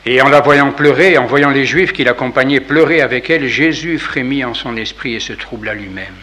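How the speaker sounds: loud, solemn scripture reading; Gospel reading. Acted. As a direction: proclaim the text to the congregation. Reads the Gospel aloud. (lecture solennelle de l'Évangile)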